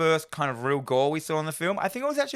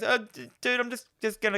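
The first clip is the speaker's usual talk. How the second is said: mock nervous stammer; 10 semitones higher than usual. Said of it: "he goes".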